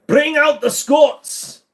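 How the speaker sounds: loud, slow Scottish war cry; Scottish battle cry. Acted. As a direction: bellow it like a Highland chieftain.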